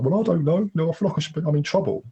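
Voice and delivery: high, anxious, half-tearful; lost and upset. Retold or imitated. Telling his sister he feels he's in trouble, high and anxious. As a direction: let the worry push the voice up. Retelling himself confused and upset.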